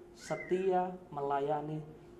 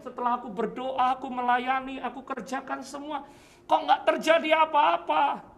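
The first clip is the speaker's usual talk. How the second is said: complaining prayer; 8 semitones higher, 4 dB louder than usual. Retold or imitated. Voicing a disappointed believer's prayer.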